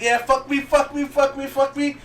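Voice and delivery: hammed-up high moans, lightly voiced; mock moaning. Woman moaning under the tattoo needle, mock moan. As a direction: ham up the moaning. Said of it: "She's like".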